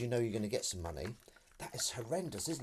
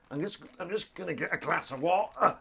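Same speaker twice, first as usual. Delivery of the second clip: higher-pitched put-on Derby accent; mock Derby accent; 6 semitones higher, 4 dB louder than usual. Guessing how a Derby local asks for water, mock Derby accent. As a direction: have a rough go at a Derby accent. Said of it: "That's not your accent".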